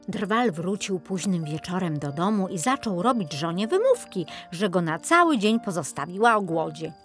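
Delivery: usual speaking voice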